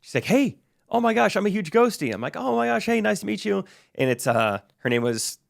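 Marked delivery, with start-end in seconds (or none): 0.2-2.1 high-pitched mock fangirl greeting; excited fan. Fan stops the performer on his way, excited. 2.4-3.6 high-pitched retold hello to a fan; delighted greeting. Performer meets a fan who stopped him, delighted.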